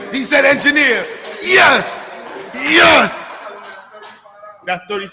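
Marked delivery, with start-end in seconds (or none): 0.1-3.5 loud high celebration; jubilant shout. Real, not acted. Host celebrating the boy's answer with loud shouts. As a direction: shout for joy as he finally says it. Child finally says engineer.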